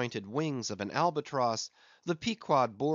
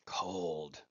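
cold shiver; 7 semitones lower, 7 dB quieter than usual. Acted. Ahab shivers.

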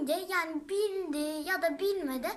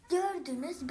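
usual speaking voice

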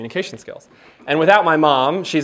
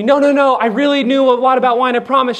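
higher, louder, insistent denial; pleading protest; 8 semitones higher, 5 dB louder than usual. Retold or imitated. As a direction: beg her to believe you. Pleading to Chelsea.

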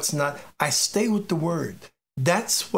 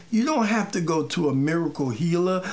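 ordinary delivery

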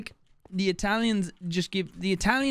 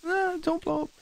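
mock high voice; 12 semitones higher than usual.

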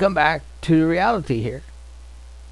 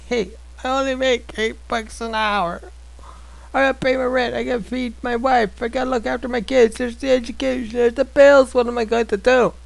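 higher, complaining working man; whining excuses; 8 semitones higher than usual. Retold or imitated. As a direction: rattle off excuses with mock worry. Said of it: "people excusing themselves to God".

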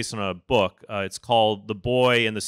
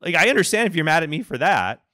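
higher, lightly glib comeback; a touch of sarcasm, pitched up; glib retort; 8 semitones higher than usual. Retold or imitated. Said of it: "a little bit glib".

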